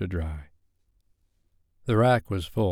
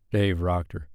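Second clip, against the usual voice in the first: usual speaking voice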